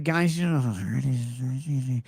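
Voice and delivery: slow, drawn-out whine; whiny voice. Shown as a demonstration. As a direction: whine it out, dragging the words. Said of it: "trying to whine is like".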